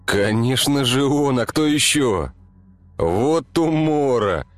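high cheerful man's voice; gleeful, amused grumbling; Mikhail's voice. Acted. Mikhail's voiced inner thought.

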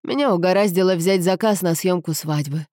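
ordinary delivery

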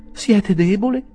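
high, loud worried question; anxious. A narrator's reading. Rachel to the wounded Tupman, anxious. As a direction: ask with fussing concern. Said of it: "chiese ansiosamente Rachel".

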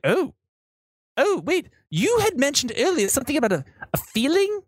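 high, stammering guide voice; nervous tour guide. Acted. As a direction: ask uneasily, tripping over words.

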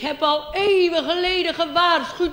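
high, loud, slow quavering prophet voice; old angel. Pretend. Old angel warned centuries ago, high and slow. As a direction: drag it out like an ancient angel. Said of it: "een hele oude witte engel".

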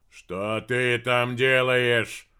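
loud, slow furious bark; angry bark. Acted. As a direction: snarl the question. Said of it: "рявкнул Дердагентур".

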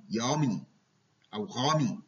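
يامي with y for r, غامي with gh for r; child swapping the r sound in يامي; mispronounced r. Shown as a demonstration. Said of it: "رامي".

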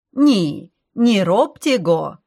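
put-on loud, slow Polish-accented protest; hasty Polish objection. A narrator's reading. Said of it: "поспешил возразить владелец дома".

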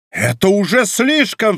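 indignant cry, pitched high; exclaiming. A narrator's reading. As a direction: cry out in protest. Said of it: "вскричал Бекингем".

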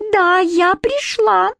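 high, slow, drawn-out fairy squeak; squeaky fairy. A narrator's reading. Trix answering the fairy council, squeaky. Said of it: "пропищала Трикс".

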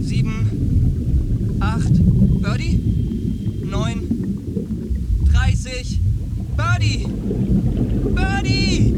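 loud, frantic calls, rising to a scream; desperate shouting. Acted. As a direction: yell his name in panic. Shouting for Birdie, alarm. (verzweifeltes Rufen, immer lauter)

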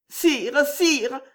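deux « Sire » aigus et hésitants; bafouille, toute frémissante; balbutiant, frémissante. A narrator's reading. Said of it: "balbutia Juliette frémissante". (Juliette bouleversée balbutie devant le roi)

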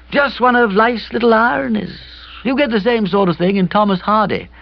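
put-on high, plaintive young man; hapless young friend. A narrator's reading. Kipper laments the misunderstanding to his friend, hapless and plaintive.

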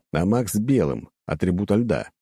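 usual speaking voice

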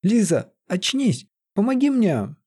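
high-pitched acted plea for help; urgent appeal. A narrator's reading. Sorcerer rousing unresponsive Лиза, urgently appealing. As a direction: call urgently, pitch climbing.

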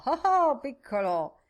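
high, loud call to a horse; hearty shout, then settling. A narrator's reading. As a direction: holler at the skittish horse. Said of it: "e urlò".

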